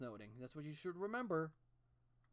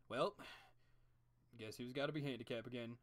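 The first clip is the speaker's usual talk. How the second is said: ordinary talk, nothing marked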